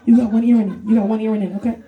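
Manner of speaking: quick, lightly voiced nosy coworker; coworkers quoted. Coworkers at work pointing out one earring, mock voice. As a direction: rattle it off like a busybody. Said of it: "people would just like".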